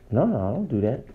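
low, soft, offhand denial; lightly voiced sister. Imam voicing the sister on the phone, low and offhand. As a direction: shrug it off, a hint of the sister. Sister's reply in retold call.

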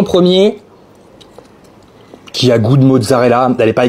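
chewing while talking; speaking around a bite; mouth full. Real, not acted. Seen 0: Bites mozzarella at 1.3–2.3, then talks while chewing; face hidden after.

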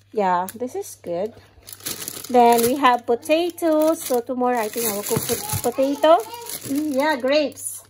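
ordinary talk

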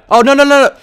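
high, loud cry of dismay; rapid-fire panicked refusals; alarmed yell. Real, not acted. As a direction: cry out as the game goes wrong. About to die in game.

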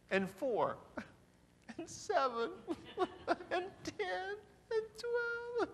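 high, laughing near tears, slowing down; hysterical laughing rant. Acted. Stressed spouse laughing through the messages, high and near tears. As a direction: cackle through the list of messages.

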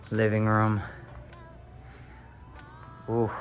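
ordinary delivery

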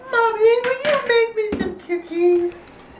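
high, slow mock-pleading; high drawn-out teasing imitation; mimicking a begging child. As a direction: mimic a kid begging, stretched out. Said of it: "because someone's like".

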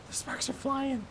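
soft, small-voiced worry; quiet nervous aside; hushed alarm. Real, not acted. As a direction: say it under your breath, alarmed. Scared aside to himself.

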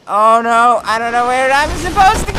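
fast, high, loud and rising to a scream; panicked. Real, not acted. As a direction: panic climbing into a long shouted "no". Lost while being chased.

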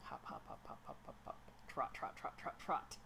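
soft whispered hop hop; whispering. Pretend. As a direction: breathe the words with no voice. Voicing the character's hopping, trotting.

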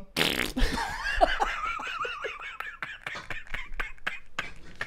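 high silent wheezing laughter. Real, not acted. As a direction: laugh until no sound comes out. Helpless laughter at punchline. (lautloses, hohes Lachen)

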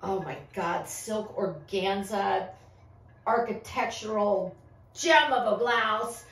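normal talking voice

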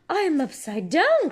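a delighted high cry; excited cry. A narrator's reading. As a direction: squeal it with surprise. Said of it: "cries Peppa".

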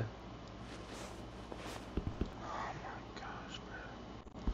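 breathless whispered my gosh; stunned, voiceless hush. Real, not acted. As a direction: drop to a bare whisper. Stunned aside.

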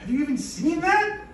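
shouting in disbelief at the absurd request; incredulous shout. Real, not acted. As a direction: yell it in disbelief.

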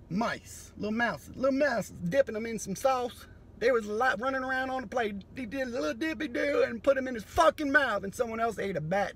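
ordinary delivery

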